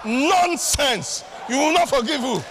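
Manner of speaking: high, loud indignant African parent; hammed-up parental shout of disbelief; old African parent. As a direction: scold a child in mock outrage. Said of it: "Old African parent day".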